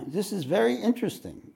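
high, lightly voiced quote of the teacher; a light take on Suzuki Roshi; reading Suzuki Roshi. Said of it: "Suzuki Roshi says".

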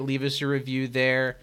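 normal talking voice

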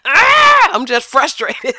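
loud, high exasperated groans into words; frustrated outburst. Acted. Host venting at the top of the show, frustrated.

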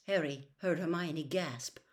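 ordinary delivery, nothing marked

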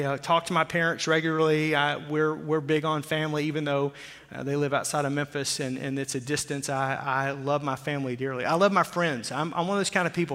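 ordinary talk

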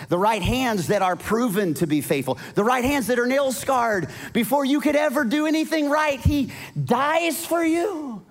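high, soaring preacher's cry, slowing at the end; preaching at full force. Acted. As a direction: stretch out he dies for you. Preaching peak about Jesus.

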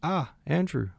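high, swooping little exclamation; pleasant surprise. A narrator's reading. Princess Mary notices her brother, pleasantly surprised. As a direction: greet him with a sudden lift in pitch.

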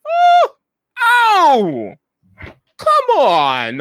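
high, loud yelps of protest; pained, high-pitched acted outcry; Archibald. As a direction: yelp as if just stabbed, then complain. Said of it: "you hear Archibald goes".

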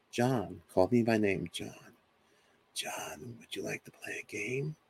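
a hint of an eerie little girl, softening to a hush; lightly voiced ghost child calling his name quietly; little girl's voice. Father imitates the unseen girl calling him, lightly voiced and hushed. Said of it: "little girl's voice too".

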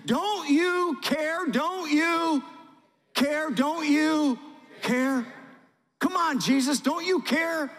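high, loud, stretched cries of don't you care; desperate pleading. Acted. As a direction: beg frantically, voice straining. Disciples begging in the storm.

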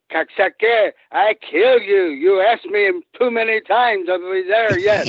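enraged wrestler yelling at his pestering buddy; furious shout. Retold or imitated. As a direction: bellow it like a giant losing his temper. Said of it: "and he's French Canadian, and he goes".

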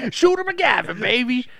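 high, loud cocky crow; hammed-up victory boast; Shooter McGavin. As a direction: brag it like a champion. Imitates film golfer's boast.

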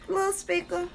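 put-on high, teasing Southern accent; mock down south voice. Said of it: "that down south accent".

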